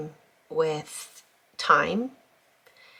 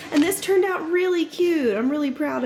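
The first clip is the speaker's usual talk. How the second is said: excited; 11 semitones higher, 4 dB louder than usual. Real, not acted. Excited about finished craft.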